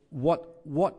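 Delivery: ordinary talk, nothing marked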